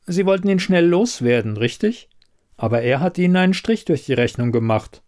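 a hint of a knowing investigator; lightly voiced shrewd investigator. Elida probing the quizmaster about the candidate, lightly voiced shrewdness.

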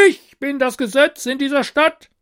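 high, loud royal roar; roaring tyrant king. A narrator's reading. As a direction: roar and pound the table. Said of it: "brüllte der König".

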